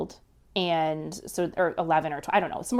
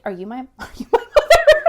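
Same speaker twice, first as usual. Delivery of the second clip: high, louder, laughing through the question; half-laughing disbelief; 6 semitones higher, 9 dB louder, faster than usual. Retold or imitated. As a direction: giggle out an absurd accusation. Phoning aunt, absurd question.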